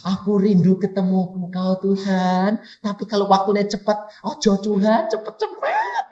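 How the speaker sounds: plaintive imitation, ending very high; mock pleading believer. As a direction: mimic a reluctant believer begging. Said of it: "gitu kok bilang".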